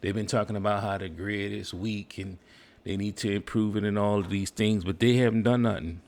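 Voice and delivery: usual speaking voice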